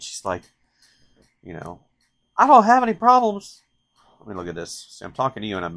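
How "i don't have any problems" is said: high, loud Southern teacher voice; Southern accent. Retold or imitated. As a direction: impersonate a feisty Southern teacher. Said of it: "she's Southern".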